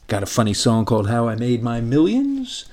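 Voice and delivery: ordinary talk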